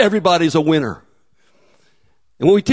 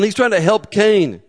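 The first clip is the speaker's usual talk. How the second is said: ordinary talk, nothing marked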